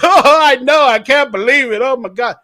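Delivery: loud mock laughing reply; hammed-up giggly quote; imitated laughing. Host imitating the man laughing at his own loss, loud. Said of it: "And he's laughing at it".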